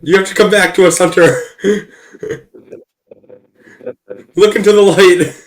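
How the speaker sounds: loud, hammed-up spirit summoning; dramatic calling out. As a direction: call him back from beyond. Mock séance calling Hunter.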